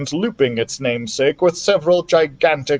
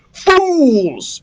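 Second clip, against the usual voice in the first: high, loud furious shout at the fools; furious cry; 11 semitones higher, 4 dB louder than usual. A narrator's reading. Captain shouting at crew over escaping prisoners, furious. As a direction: yell it high and enraged. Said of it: "he cried".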